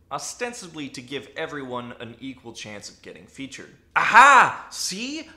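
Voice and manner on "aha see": high, loud mock "gotcha" voice; triumphant objector. Imagined critic pouncing on a flaw in the argument, hammed-up triumph. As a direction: burst in crowing, hammed-up.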